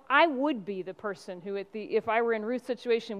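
normal talking voice

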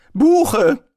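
loud, high, firm answer; fest. A narrator's reading. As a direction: declare it firmly in one word. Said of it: "erwiderte er fest". (laut und bestimmt erwidert)

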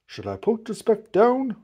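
mildly anxious question; alarmed wondering. A narrator's reading. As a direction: worry aloud, understated. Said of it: "Horton thought with alarm".

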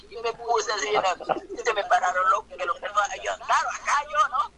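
ordinary delivery

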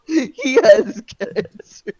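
breaking into laughter, slow and loud; can barely get it out for laughing. Real, not acted. Cracking up mid-sentence.

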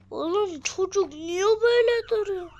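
loud, high, exasperated yell; exasperated shout. Real, not acted. Gamer shouts at a kid standing oddly, exasperated. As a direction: shout it in disbelief. Yelling at game character.

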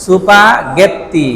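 সুপাগেত্তি stretched very slowly, mora by mora; slow katakana reading. Shown as a demonstration. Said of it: "স্প্যাগেটিকে কি বলা হয়".

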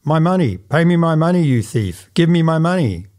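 furious miser yelling for his money; shouting. A narrator's reading. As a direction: bellow it from the landing. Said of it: "cry out at the top of his voice".

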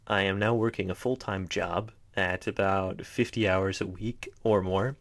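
usual speaking voice

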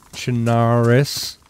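slow "Chernarus" with an open "ah", cher-NAH-rus; corrected pronunciation. Shown as a demonstration. Player trying the commenter's correction, "Chernarus" as slow cher-NAH-rus. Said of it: "that's not the way we talk".

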